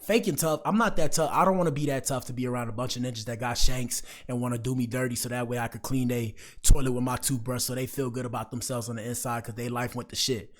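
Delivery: usual speaking voice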